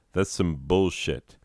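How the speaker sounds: ordinary delivery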